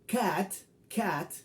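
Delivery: cat said with a short open a; English cat. Shown as a demonstration. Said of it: "pero el sonido es A". (cat con a abierta y corta)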